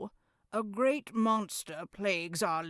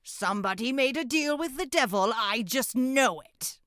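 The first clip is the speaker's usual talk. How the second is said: fast, higher rushed accusation; agitated accusation; 5 semitones higher, 5 dB louder, faster than usual. Acted. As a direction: blurt it out in a panic.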